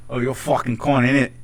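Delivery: lightly imitated London slang talker; London accent. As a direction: put on a South East London bloke. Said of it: "it's just like".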